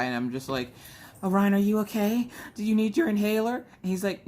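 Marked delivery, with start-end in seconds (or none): 1.2-3.6 fake casual concern. Mom covering her own panting, calling to her son. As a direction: act fine while hiding your panting. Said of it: "divert everybody's attention off of me huffing and puffing".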